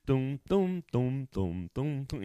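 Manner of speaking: mock drumroll before the big choice; hummed suspense music. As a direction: hum a game-show suspense tune.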